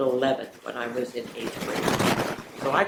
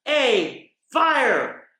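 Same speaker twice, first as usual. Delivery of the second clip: each word climbing higher and louder; excited child battle cry; 8 semitones higher, 5 dB louder, much slower than usual. Retold or imitated. Little girl calling to dad before leaping, rising excited countdown. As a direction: call it out like a little girl charging. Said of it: "and I would go".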